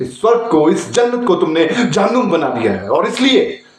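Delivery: high-pitched, indignant stage tirade; impassioned condemnation. Acted. Prisoner condemns mankind in monologue. (ऊँचे स्वर में धिक्कार)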